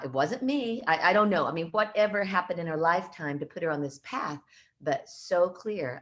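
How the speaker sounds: ordinary talk, nothing marked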